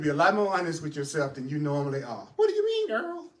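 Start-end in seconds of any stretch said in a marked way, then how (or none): 2.4-3.3 very high squeaky-pitched comic student voice; little invisible student. Acted. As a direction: pipe up high as a puzzled little pupil. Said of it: "That's my little invisible student".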